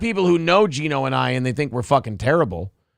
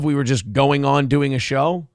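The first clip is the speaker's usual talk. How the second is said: ordinary talk, nothing marked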